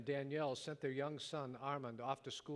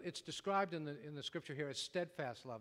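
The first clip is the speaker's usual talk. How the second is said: ordinary delivery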